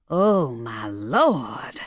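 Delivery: low, slow pained moan. A narrator's reading. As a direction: drag it out as a heavy groan of dismay. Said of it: "Virgil moaned".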